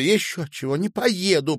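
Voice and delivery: loud, high refusal; defiant. A narrator's reading. Woman refusing man's offer of a ride, defiant.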